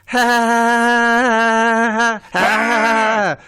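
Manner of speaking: high, slow "ha, ha" fake laugh; slow forced laugh. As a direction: drag out a hammed-up laugh. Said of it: "You were a bit slow then".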